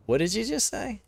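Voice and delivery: shocked designer. Retold or imitated. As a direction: play her caught off guard, high and sharp. Retelling the female designer's shocked reaction.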